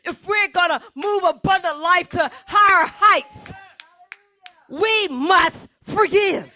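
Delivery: loud, pounding pulpit preaching; a pastor preaching at full force. Acted. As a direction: drive every "you must forgive" home to the congregation. Preaching to the church.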